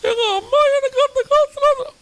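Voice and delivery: very high, whiny sobbing wife voice; crying wife. Retold or imitated. Wife answers her husband's question in a whiny, tearful voice. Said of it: "இப்ப நீ எதுக்காக அழுவுற?".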